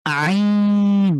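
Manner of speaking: আইন squeezed from mid-throat, long and drawn out; long Arabic ain from the throat; throaty ain. Shown as a demonstration. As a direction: press the throat and hold আইন. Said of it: "কিভাবে উচ্চারণ করছি".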